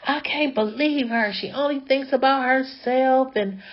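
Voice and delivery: a hint of a sister venting on and on; going off. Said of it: "she was just going off".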